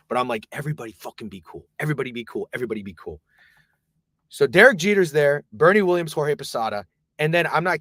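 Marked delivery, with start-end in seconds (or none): 0.5-3.2 quick, hushed aside to friends; soft, lowered warning; low urgent aside. Retold or imitated. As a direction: keep it down and keep them cool. Hushing friends near the star.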